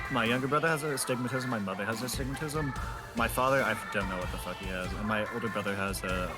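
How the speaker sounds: normal talking voice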